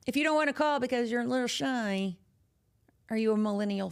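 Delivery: ordinary talk, nothing marked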